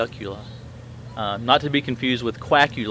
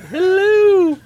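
much higher, loud falsetto nanny greeting; Miss Doubtfire voice; 10 dB louder than usual. Retold or imitated. Nanny housekeeper greets in falsetto, loud and high. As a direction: squeal it like a booming old nanny. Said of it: "her voice sounds like Miss Doubtfire".

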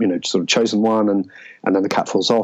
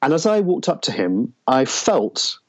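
usual speaking voice

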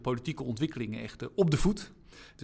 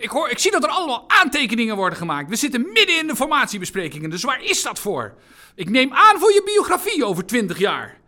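loud, high-pitched indignant rebuke; Alexander Pechtold calling out; 8 semitones higher, 9 dB louder than usual. Retold or imitated. Party leader rebukes note-taking newcomer during formation talks, calling out indignantly. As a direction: call out a scolding across the meeting. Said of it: "hoorde ik ineens Alexander Pechtold roepen".

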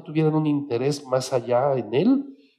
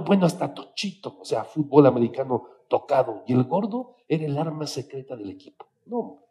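usual speaking voice

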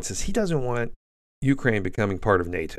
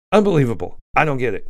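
exasperated; 4 semitones higher, 6 dB louder than usual. Real, not acted. Incredulous outburst at opponents.

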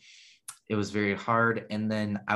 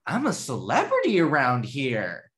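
much higher, louder playful celebrity boast; joking excitement; 5 dB louder than usual. Retold or imitated. Showing off to the brother, hammed-up giddy boast. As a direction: ham it up like a thrilled star. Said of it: "I went completely opposite".

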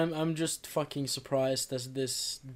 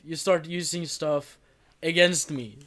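ordinary delivery

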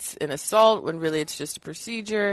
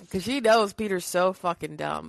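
ordinary talk

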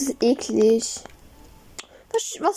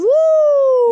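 hoher, lauter Jubelschrei; excited whoop; 12 semitones higher, 7 dB louder than usual. Real, not acted. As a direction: juble laut hinaus. Jubel über Pokémon. (lauter, hoher Jubelschrei)

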